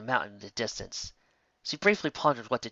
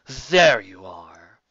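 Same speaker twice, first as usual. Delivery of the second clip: menacing captor; 4 semitones lower, 10 dB louder, much slower than usual. A narrator's reading. Pursuers catch up with Scootaloo.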